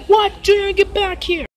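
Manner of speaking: high shout to call him back; shouting after him. Acted. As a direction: yell at the kid running off. Parent shouting after runaway child.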